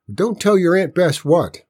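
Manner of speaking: sharp, high, loud demand; stern aunt demanding an answer. A narrator's reading. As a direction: cut in like a suspicious aunt. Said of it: "Aunt Bess demanded as her thin form".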